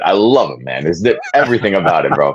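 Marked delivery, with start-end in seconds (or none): none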